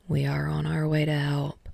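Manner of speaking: Shoto announcing. A narrator's reading. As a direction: announce it quietly to the team. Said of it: "Shoto's voice announced".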